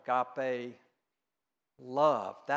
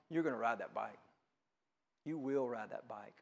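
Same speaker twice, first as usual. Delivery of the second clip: soft, low, gentle promise; tender parent, hushed and certain; loving reassurance; 6 semitones lower, 8 dB quieter than usual. Acted. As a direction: quietly assure a child they will manage it. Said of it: "your mother or sibling love you".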